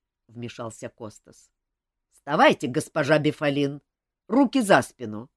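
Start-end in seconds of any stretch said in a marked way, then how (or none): 2.1-5.3 loud, clipped order to stand; stern command. Acted. Kostas ordering the viscountess up, loud command. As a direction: bark the arrest order.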